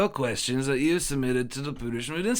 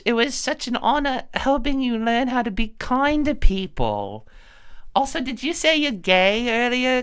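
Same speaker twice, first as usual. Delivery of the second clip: put-on wholesome 1950s kid; 7 semitones higher than usual. Child character.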